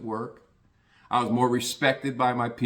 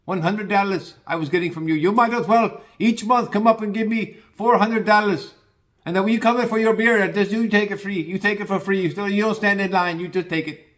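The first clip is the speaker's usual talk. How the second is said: put-on high, loud Indian shopkeeper accent; 9 semitones higher, 4 dB louder than usual. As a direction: play the Indian store owner teasing him in an Indian accent. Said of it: "the little Indian guy that runs the place goes".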